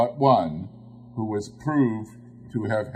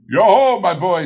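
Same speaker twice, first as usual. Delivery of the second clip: much higher and louder, a hearty merry hail; jovial Fezziwig voice; 9 semitones higher, 11 dB louder than usual. A narrator's reading. Fezziwig greeting his apprentice, loud jovial hail. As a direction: call out like a delighted old master. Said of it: "said Fezziwig".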